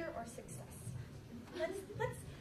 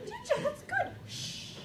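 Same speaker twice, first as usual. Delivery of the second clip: fake-sweet shush; 13 semitones higher than usual. Shushing the kid.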